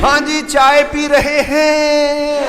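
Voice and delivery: high, drawn-out polite reply; meek sons. Retold or imitated. Sons answering their shouting father, high and slow. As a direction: answer dad sweetly, slowly. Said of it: "sons answering father".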